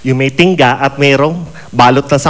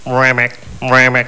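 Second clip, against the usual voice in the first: frog call; 5 semitones lower than usual. Pretend. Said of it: "Sabi ko".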